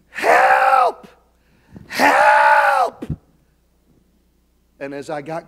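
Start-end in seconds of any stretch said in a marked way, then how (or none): 0.1-3.1 full-voiced shout for help, much higher; cry for help. Beaten victim on the street shouting to the passing pastor. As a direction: scream it like you're being beaten. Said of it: "And so you said".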